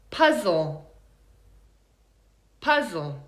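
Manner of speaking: "Puzzle" as PUZ-ul, falling to a weak ending; reduced second syllable. Shown as a demonstration. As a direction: stress the start of "Puzzle", schwa at the end. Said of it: "It's the second one".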